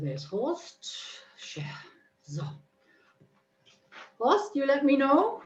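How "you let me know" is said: usual speaking voice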